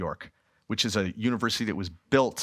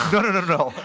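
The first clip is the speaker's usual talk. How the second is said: louder, higher, giggling "no, no, no"; laughing protest; 6 semitones higher, 4 dB louder, faster than usual. Retold or imitated. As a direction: beg to be thrown out, half laughing. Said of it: "I'm like".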